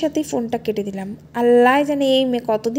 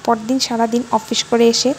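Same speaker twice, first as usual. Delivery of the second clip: ordinary delivery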